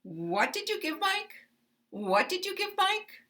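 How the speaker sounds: What did you give Mike rising high; uptalk. Shown as a demonstration. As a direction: lift the pitch up through Mike. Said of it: "rising my intonation".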